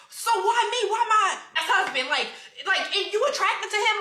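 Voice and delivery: high, laughing disbelief; incredulous laughing. Retold or imitated. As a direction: laugh through the accusation in disbelief. Confronting sister about ex-husband.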